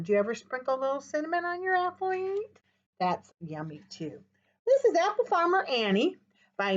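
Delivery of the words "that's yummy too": softer and lower in delight; a hushed, tasty murmur; yummy. Acted. Teacher to kids about cinnamon apples, hushed delight.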